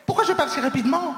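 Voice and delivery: high, fast and loud. Shown as a demonstration. Comic on stage demonstrating fast talk, loud and fast. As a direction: rattle it off at speed.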